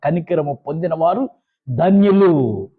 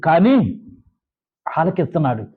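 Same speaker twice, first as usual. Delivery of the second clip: normal talking voice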